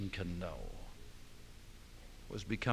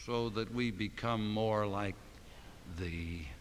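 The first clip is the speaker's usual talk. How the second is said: prayer. Acted. Pastor leads closing prayer.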